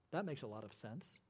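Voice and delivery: low, quiet deadpan sarcasm; sarcastic understated aside; dry sarcasm. Real, not acted. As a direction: drop it low and soft, drily ironic. Mocking the objection's backwards logic.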